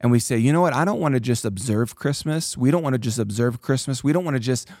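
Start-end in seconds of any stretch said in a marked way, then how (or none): none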